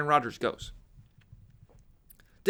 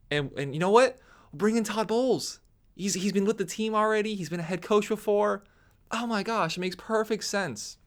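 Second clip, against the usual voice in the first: higher, eager, rushed executive voice; mock front office executive; 6 semitones higher than usual. Front office talking Bruce Arians into management, higher eager mock voice. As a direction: ham up a nervous boss smoothing things over.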